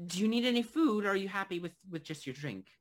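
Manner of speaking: helpful innkeeper, starting high and easing down; lightly voiced barkeep. Barkeep offers the regular food, lightly voiced. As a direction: offer service in a light character voice.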